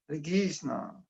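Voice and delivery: "Hare Krishna" softened to "Hari Kisna"; regional pronunciation. Shown as a demonstration. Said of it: "You might say".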